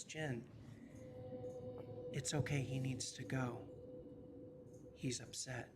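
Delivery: hushed reassurance to Jin; soft reassuring. Acted. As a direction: ease him down quietly. [name] calms Jin.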